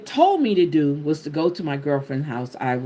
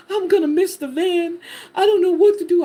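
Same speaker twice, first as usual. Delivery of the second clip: high, louder, tearful desperate plea; retold crying; 12 semitones higher, 4 dB louder than usual. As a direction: sob it out, near panic. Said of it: "I was crying".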